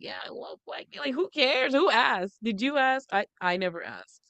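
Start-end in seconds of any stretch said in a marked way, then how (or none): 0.0-1.0 breathy mocking whisper of their boast. Mocking the women who brag, whispered. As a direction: whisper it like a smug confession.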